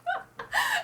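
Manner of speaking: real high giggle after a long pause; amused laugh. Real, not acted. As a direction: crack up at the absurd threat. Laughs at the absurd threat.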